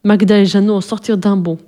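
ordinary delivery

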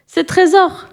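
high shout stressing Trésor; eager shout. Acted. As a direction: call out the hero's name proudly. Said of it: "cria Mireille Corneille".

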